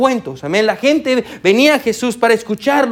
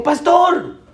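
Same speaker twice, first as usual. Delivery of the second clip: calling out; 8 semitones higher than usual. Retold or imitated. Stranger calls out at elevator.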